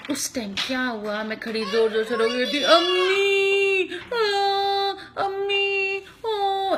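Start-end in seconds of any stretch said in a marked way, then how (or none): 2.6-6.8 high, drawn-out wailing calls for mummy; crying for mommy. Retold or imitated. To her kids, as the lost girl calling her mother, high crying wail. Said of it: "ऐसे रो रही थी ना".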